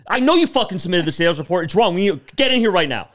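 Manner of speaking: loud, high scolding; angry boss. Retold or imitated. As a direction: tear into the employee.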